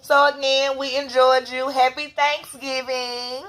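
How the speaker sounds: high bright outro voice; cheerful sign-off. Real, not acted. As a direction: wave goodbye to viewers warmly.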